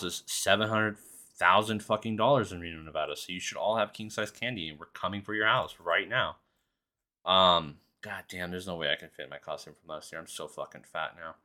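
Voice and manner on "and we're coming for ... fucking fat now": usual speaking voice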